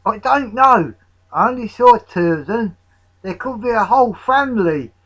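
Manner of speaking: high, loud fretting; worked-up alarm. A narrator's reading. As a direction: fret loudly to your mates. Nigel frets to his friends.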